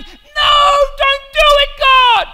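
high, loud pleading shout; angels' panicked protest. Acted. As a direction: yell it up at God in alarm. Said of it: "And the angels are going".

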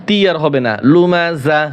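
usual speaking voice

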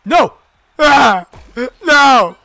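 high, loud cries of no; despairing yell. Real, not acted. Streamer sees the match turn, loud despairing cries. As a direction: shout in shock at the loss. Losing moment in game.

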